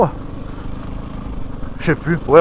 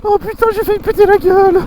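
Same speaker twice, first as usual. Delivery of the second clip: startled cry; 11 semitones higher than usual. Real, not acted. Nearly crashes the bike.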